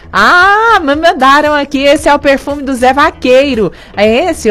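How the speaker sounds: sudden high, loud burst of delight; thrilled at the perfume from a listener; excited. Real, not acted. Radio host showing off the [name] perfume, excited.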